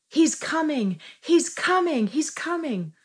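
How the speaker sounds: imitating a child's high, loud yell; high-pitched excited cry, each time falling away. Retelling how Hadassah burst into the room, excited yell. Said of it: "and yelled".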